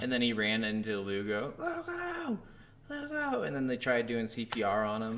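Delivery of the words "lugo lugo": high-pitched, held-back cry of a name; mock calling out. Retelling how he ran into Lugo, mock cry. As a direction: imitate a distressed shout without the volume. Acting out soldier finding Lugo.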